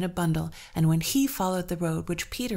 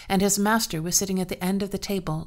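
ordinary delivery, nothing marked

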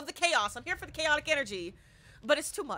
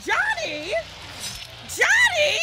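very high loud yell; shout; 7 dB louder, slower than usual. Real, not acted. Streamer screaming at the killer Johnny, high and loud. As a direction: scream the name out.

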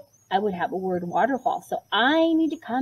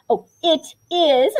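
self-correction; 5 semitones higher, 5 dB louder, slower than usual. Real, not acted. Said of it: "I said this one first".

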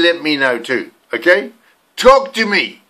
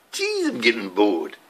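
lower, quieter Maori English accent; 7 semitones lower, 9 dB quieter than usual. Shown as a demonstration. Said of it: "I thought today I'd talk like a Maori".

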